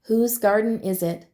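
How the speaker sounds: usual speaking voice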